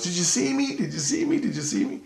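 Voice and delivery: high-pitched, eager retold child; mimicked high-voiced excitement; excited little girl. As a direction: imitate a little girl excitedly repeating herself. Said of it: "she was all excited".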